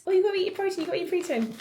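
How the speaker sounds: high, sing-song mock of a health nut; mocking nagger. Vlogger mocking people who tell her to eat protein, high mock voice. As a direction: parody the protein-pushers.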